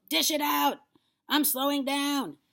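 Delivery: high, loud, put-on old woman; demanding yell. A narrator's reading. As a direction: yell impatiently for it. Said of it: "she yelled".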